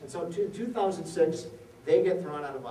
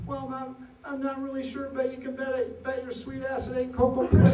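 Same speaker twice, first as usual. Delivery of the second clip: put-on pitched higher as a frightened little boy, stammering; a hint of a terrified kid stumbling over his words; frightened five-year-old answering the angry mother, stammering; scared stammering boy; 9 semitones higher, 5 dB louder than usual. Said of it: "he's stammering".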